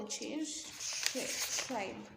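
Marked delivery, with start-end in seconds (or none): 1.1-2.0 tri- breathed out then tribe said clearly; isolated syllable. Shown as a demonstration. Teacher isolating the first syllable for students, slow and split. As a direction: break off the first syllable of tribalism. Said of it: "stress the first syllable".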